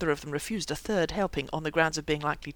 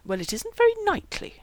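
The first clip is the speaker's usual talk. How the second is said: high, louder motherly reproof; stern; 5 dB louder than usual. A narrator's reading. Mother telling her son it is unknightly, sternly. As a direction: reprove him, pitched well up. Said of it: "she told him sternly".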